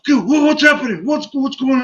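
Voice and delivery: high-pitched mock alarm; retold alarm.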